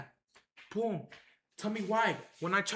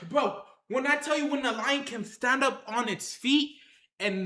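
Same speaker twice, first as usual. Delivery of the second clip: ordinary talk, nothing marked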